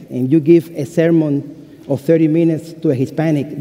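ordinary talk, nothing marked

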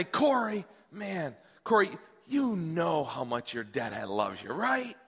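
slow, softening fatherly declaration; tender dad. Retold or imitated. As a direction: tell your little boy gently, drawing it out. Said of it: "I'm gonna tell him".

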